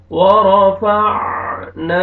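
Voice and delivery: ورفعنا with ع from its proper throat point and a long final الف; clear ain. Shown as a demonstration. Teacher models correct ain in ورفعنا. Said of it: "عین کو اپنے مخرج سے ادا کریں گے".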